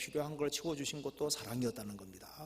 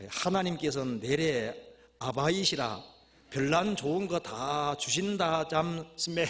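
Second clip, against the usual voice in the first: put-on slow, emphatic Hamgyong accent; Hamgyong dialect; 4 semitones higher, 6 dB louder, slower than usual. Preacher reading the Hamgyong version of the verse, Hamgyong dialect. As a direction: ham up the Hamgyong dialect with long vowels. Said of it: "함경도 버전".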